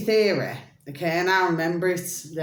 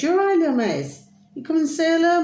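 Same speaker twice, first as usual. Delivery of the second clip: put-on high cooing baby talk, louder; pet cooing; 11 semitones higher, 5 dB louder, faster than usual. Cooing to little Maze, high baby talk.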